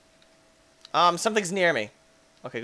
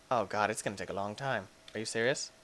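normal talking voice

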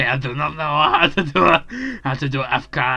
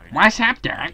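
Bugs Bunny quote; 7 semitones higher than usual. Retold or imitated. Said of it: "how many voices can you do".

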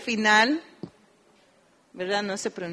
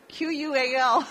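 spelling letter by letter; 4 semitones higher than usual. Shown as a demonstration. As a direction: spell it out for the class. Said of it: "spelling syllable".

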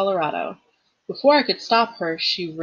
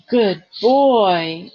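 melancholy; 2 semitones higher, 7 dB louder than usual. A narrator's reading. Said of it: "her voice sounded melancholy and uncertain".

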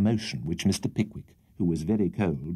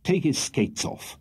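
slow, firm order; stern; 7 semitones higher, slower than usual. A narrator's reading. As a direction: lay down the command. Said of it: "said in a stern voice".

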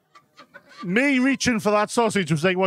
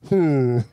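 low hammed-up moan from the rocking car; a low comic grunt of effort; mock moan; 6 semitones lower than usual. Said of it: "all you hear is".

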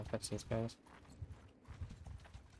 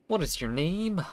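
NPC voice; 6 semitones higher, 7 dB louder than usual. Acted. NPC asks the player's name.

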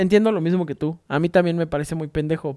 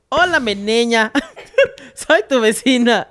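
put-on slow, slightly higher old lady with a Yucatecan accent; 5 semitones higher, slower than usual. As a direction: play the tiny Yucatecan neighbor lady, unhurried. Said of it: "me dice con un acento bien yucateco". (acento yucateco de señora mayor, lento)

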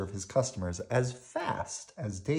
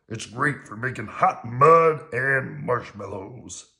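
loud, slow grouch voice; drawn-out grouchy boast; Oscar; 3 semitones higher, 8 dB louder, slower than usual. A narrator's reading. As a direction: relish each word as a gruff puppet. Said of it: "Oscar said".

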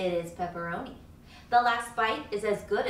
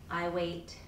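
ordinary delivery, nothing marked

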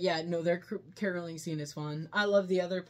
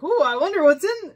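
curious delight; 10 semitones higher, 13 dB louder than usual. Retold or imitated. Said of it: "he's like".

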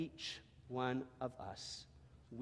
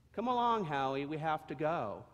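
higher, louder motherly voice hurrying him; a mama donkey urging her little one to hurry; mama donkey calling her foal; 3 semitones higher, 6 dB louder than usual. Acted. Mother donkey urging Howie along.

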